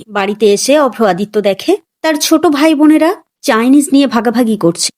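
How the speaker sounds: ordinary delivery, nothing marked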